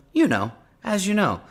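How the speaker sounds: very high mock presidential lilt; Trump accent. President talking to reporters, in a high Trump accent. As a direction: impersonate the president, pitched right up. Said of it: "in a Trump accent?".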